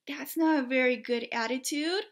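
usual speaking voice

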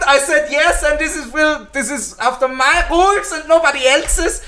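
high-pitched, hammed-up German accent. Parodying the German approach to things, hammed-up German accent. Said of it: "my English American German accent".

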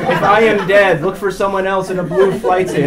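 imitated blunt safety instructions; flight crew briefing. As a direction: lay down the rules to a full cabin. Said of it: "He goes".

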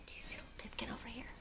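put-on fast whispered aside to the children. Reader whispering to children about little Pipkin. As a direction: lean in and whisper quickly.

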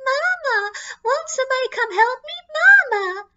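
mock sobbing baby plea; crying baby voice. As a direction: wail like a ghost child. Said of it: "starts making crying baby noises".

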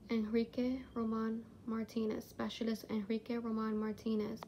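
hushed. Real, not acted. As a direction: speak quietly, as if not to disturb. Hushed spirit box session start.

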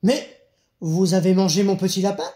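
loud, shocked outburst; exclaiming. A narrator's reading. Doctor to the wolf who ate his little rabbit, loud exclamation. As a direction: burst out loudly in indignation. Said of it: "le médecin s'exclama".

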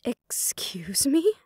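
slow, whispered disbelief; stunned whisper. Acted. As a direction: whisper it, stunned by what she heard. Shocked at elders' demand.

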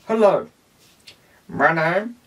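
a hint of a cheerful puppet character; Simon puppet voice. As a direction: homemade puppet Simon says hello in a light voice. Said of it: "Now Simon".

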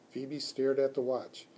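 usual speaking voice

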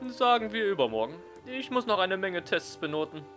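teacher voice. Acted. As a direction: play the teacher, measured and kind.

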